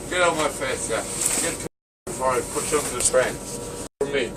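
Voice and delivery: normal talking voice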